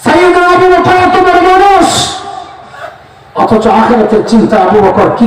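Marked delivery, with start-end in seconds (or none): 0.0-2.1 high, level preacher's proclamation; fervent preaching. Real, not acted. Preacher extolling Abu Bakr to the congregation, fervent. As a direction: pitch it high in awe.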